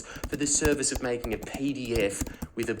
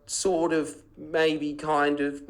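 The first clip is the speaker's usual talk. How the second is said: mock hedging; 6 semitones higher, slower than usual. Mocking the paper's hedged coverage.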